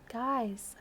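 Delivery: faint whispered word; weak, breathless whisper; barely said. Acted. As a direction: whisper it weakly. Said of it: "I barely said".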